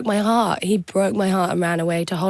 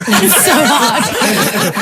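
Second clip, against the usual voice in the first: laughing; 3 semitones higher, 5 dB louder, much slower than usual. Real, not acted. Laughing after failed accent attempt.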